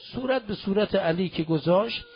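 normal talking voice

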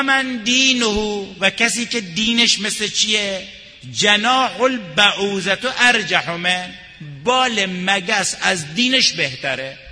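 high, loud, slow preaching; full-force preaching. Acted. Preacher scolds ignorant followers at full force, loud and high.

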